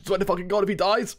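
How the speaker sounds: high, agitated swearing; worked-up warning. Real, not acted. Streamer fearing the character dies, agitated. Threatens over character possibly dying.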